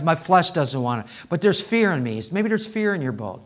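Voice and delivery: usual speaking voice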